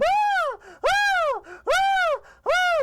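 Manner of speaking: piercing, very high loud shriek; cartoon scream. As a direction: scream at full pitch like a terrified cartoon. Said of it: "the screaming is still going on".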